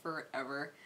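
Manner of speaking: very soft, half-whispered aside; hushed, low exasperation; drained muttering. Real, not acted. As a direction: murmur it, spent after an outburst. Winding down after angry outburst.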